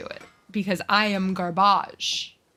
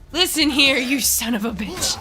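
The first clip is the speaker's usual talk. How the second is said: louder, higher mock threat at a game enemy; mock-angry scolding; 5 semitones higher, 5 dB louder than usual. Player cussing out a game enemy, mock-angry. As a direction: lay into the enemy, half in play.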